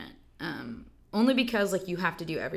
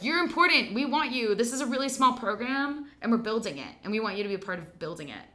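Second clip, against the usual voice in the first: voicing eager recruiters, higher and louder; 4 semitones higher, 4 dB louder than usual. To the young actor, eager recruiters pitch their small program. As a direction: gush like people courting a new talent. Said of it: "were like".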